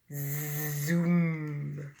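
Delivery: steady drawn-out zoom; lawnmower zoom. A narrator's reading. Teacher imitates a lawnmower for kids, steady zoom. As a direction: hold a steady motor hum. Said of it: "It goes like this".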